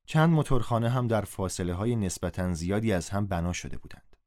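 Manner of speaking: usual speaking voice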